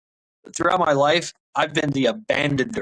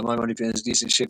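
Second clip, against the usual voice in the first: ordinary talk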